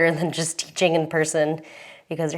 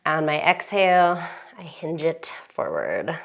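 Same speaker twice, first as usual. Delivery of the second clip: calm yoga instructor. Acted.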